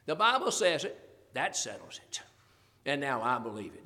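corrected saying, ending low and settled; firm correction. Shown as a demonstration.

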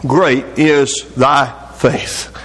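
very slow, low solemn delivery; slow solemn praise. Retold or imitated. Jesus answers the persistent woman, slow solemn praise. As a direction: draw out each word of the praise with weight.

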